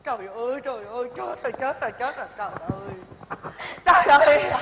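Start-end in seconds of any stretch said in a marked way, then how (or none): 0.0-2.9 despairing moan. Acted. Wife laments in despair.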